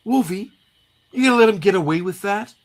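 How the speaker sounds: high-pitched, quick goading; indignant comic sidekick. Acted. Jubilee egging on Wolverine, high indignation. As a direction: pitch it way up and egg him on.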